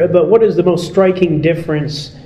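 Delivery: normal talking voice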